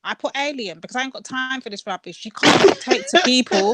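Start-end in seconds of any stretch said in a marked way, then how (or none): 2.2-3.7 loud, high emphatic outburst; emphatic shout. Real, not acted. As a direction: declare it loudly.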